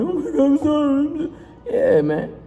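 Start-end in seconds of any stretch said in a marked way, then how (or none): 0.0-1.3 high, hammed-up sobbing apology; mock crying plea. The doomed enemy begging forgiveness, in high mock-crying pleas. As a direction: beg for forgiveness in a put-on wail. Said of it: "You're gonna be crying".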